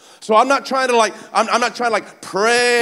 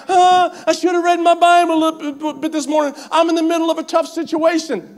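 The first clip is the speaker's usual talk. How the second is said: a hint of a fretful believer, lifted higher, mocking; fretting believer; 9 semitones higher than usual. Preacher mocking a believer who skipped Bible reading, lightly fretful. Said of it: "But it's not like".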